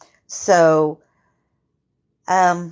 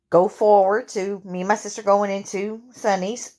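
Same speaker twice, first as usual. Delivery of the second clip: normal talking voice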